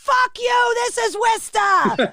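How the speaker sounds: high mock-furious shout; hammed-up hometown outrage; imitated angry crowd. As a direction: yell back like an offended local. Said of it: "And they're like".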